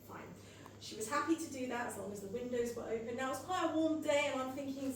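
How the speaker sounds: ordinary talk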